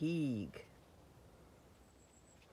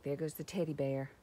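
usual speaking voice

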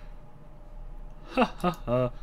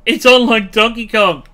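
excited shout; 6 semitones higher, 15 dB louder than usual. Real, not acted. Found enemy capital, excited shouting.

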